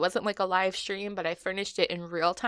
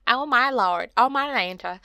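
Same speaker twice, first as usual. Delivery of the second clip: hammed-up Southern exclamation, louder; old Southern lady; 4 semitones higher, 6 dB louder than usual. Vlogger mocking her own slip, old Southern lady accent. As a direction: mimic an old Southern lady, loud and playful. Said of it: "like an old Southern lady".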